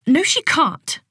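sharp snap of refusal; snapped. A narrator's reading. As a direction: cut her off sharply. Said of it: "Mother snapped".

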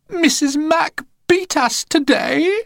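put-on high, slow whiny child voice; fake-innocent kids telling tales; lying children. A narrator's reading. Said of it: "they lied to their parents".

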